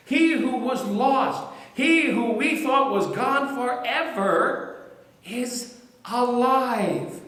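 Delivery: Mary urging doubters that he lives; ecstatic proclamation. Acted. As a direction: proclaim it with everything you have.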